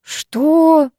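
high, stunned question; sincere shocked disbelief. A narrator's reading. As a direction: blurt it, utterly taken aback. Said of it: "искренне опешил Кайзер".

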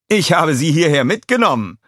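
a high, loud, delighted call to his neighbour; Walter calling. A narrator's reading. Walter calls excitedly to the Grim Reaper.

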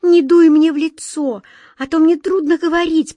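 high, loud, gasping against the wind; breathless protest. A narrator's reading. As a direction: speak into a gust. Girl protests wind in face.

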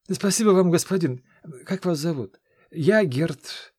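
a nervous lad begging to tag along; eager young squire. Acted. Gert grateful.

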